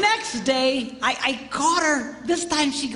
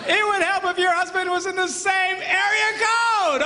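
mock exasperated lecture; 8 semitones higher than usual. Comic lecturing the girls on laws of physics, high exasperated shout.

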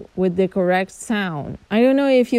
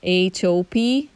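ordinary talk

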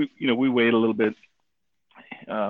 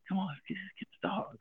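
soft, higher imitation of wife; hushed urging; 6 semitones higher, 13 dB quieter, faster than usual. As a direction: coax quietly, as an embarrassed wife would. Wife urging him, retold.

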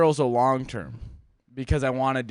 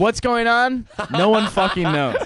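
mock shout; 9 semitones higher, 6 dB louder than usual. Mock confusion, podcast bit.

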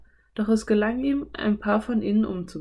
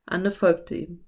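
usual speaking voice

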